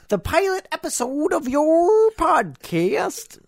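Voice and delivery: high, slow puppet frog voice; Kermit the Frog. Acted. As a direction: drawl it out like a Muppet frog. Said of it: "it's from my friend Kermit the Frog".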